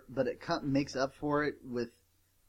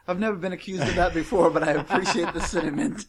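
laughing quote; 4 semitones higher, 6 dB louder than usual. Retold or imitated. Quoting the movie to friends while laughing. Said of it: "And he goes".

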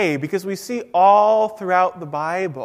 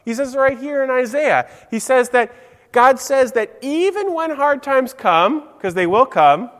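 ordinary talk, nothing marked

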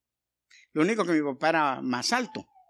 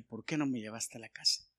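put-on lower, much softer childish reproach; softer mock complaint; 6 semitones lower, 11 dB quieter than usual. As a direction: whine it quietly, like a hurt son. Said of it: "Yo siempre le reclamé".

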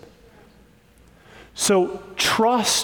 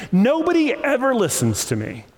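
whiny self-pity; 6 semitones higher than usual. Pretend. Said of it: "I'm trying to sound like your inner voice".

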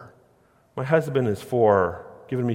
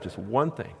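quieter repeat; 3 semitones lower, 7 dB quieter than usual. Shown as a demonstration. Preacher to congregation, restating the point, softer. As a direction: drop the repeat to a softer, settling close.

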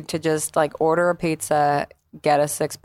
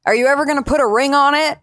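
shouted question; 9 semitones higher, 3 dB louder, faster than usual. Retold or imitated. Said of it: "He was like".

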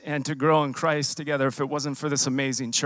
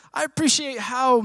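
imitating Ernie in a much higher, lifted voice; quoting Ernie; 9 semitones higher than usual. Ernie encouraging the nervous speaker, voiced much higher. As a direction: play a friend cheering him on, pitched way up. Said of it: "and he was searching for a word".

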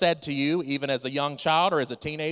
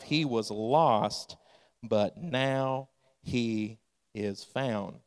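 He was lost, but now he is found, very slow and softer; very slow, softer hushed lost and found; tender slow refrain; 4 semitones lower, 4 dB quieter, much slower than usual. Acted. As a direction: let it sink in tenderly, almost hushed. Father's tender closing words.

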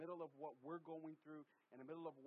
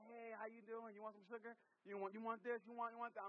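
higher-pitched, lightly voiced indulgent grandpa; doting grandfather; 6 semitones higher, 3 dB louder than usual. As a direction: play a grandfather offering treats, pitched up. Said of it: "as a grandfather".

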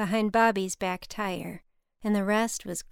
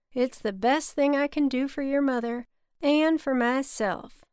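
higher-pitched, firm Southern drawl; Bobby, thick Southern drawl; 5 semitones higher, 3 dB louder than usual. A narrator's reading. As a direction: refuse gently in a cotton drawl. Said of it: "in his thick cotton drawl".

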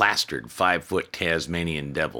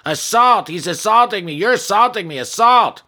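shrill high shouting, far louder; drunk yelling; 11 dB louder than usual. Acted. Drunk gambler yelling assault at the manager. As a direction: yell it like a drunk caught punching a machine.

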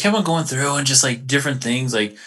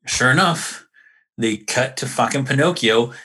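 ordinary talk

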